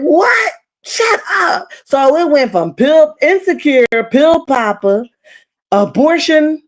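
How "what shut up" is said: high, stunned yelp; shocked outcry. Retold or imitated. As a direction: cry out in shocked disbelief. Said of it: "I said".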